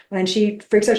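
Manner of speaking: ordinary talk, nothing marked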